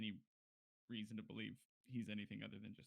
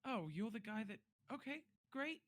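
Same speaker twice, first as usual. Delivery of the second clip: startled; 12 semitones higher than usual. Acted. Guard startled at champion claim.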